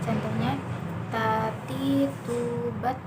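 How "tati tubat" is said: "tati, tubat" with a little breath flowing on each t; slightly aspirated t. Shown as a demonstration. Teacher giving examples of the letter Ta, t with flowing air. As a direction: release some air on the t's of "tati, tubat". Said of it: "dengan mengalirkan sedikit nafas kita".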